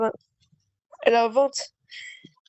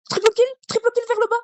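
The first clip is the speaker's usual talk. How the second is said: excited shout; 9 semitones higher, 3 dB louder, faster than usual. Real, not acted. Excited callout mid fight.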